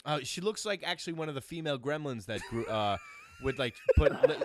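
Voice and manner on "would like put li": words dissolving into high laughter; laughing. Real, not acted. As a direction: breaks up laughing mid-sentence. Breaks up mid-story.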